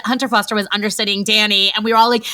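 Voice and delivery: ordinary talk, nothing marked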